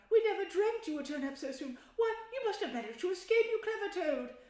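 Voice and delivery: high, loud, eager praise; admiring Mole. A narrator's reading. Mole praising Toad's escape, eager and high. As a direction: gush over the clever friend.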